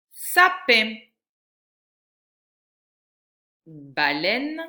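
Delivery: carefully articulated word list, spaced out; teacher reading picture words aloud; slow, loud word reading. Shown as a demonstration. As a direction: read each word loud and clear for a child. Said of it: "reading each word aloud clearly".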